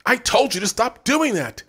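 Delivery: high, loud scolding; angry. A narrator's reading. Dina scolding Brent angrily as she enters. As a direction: tell him off sharply. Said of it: "Dina said angrily".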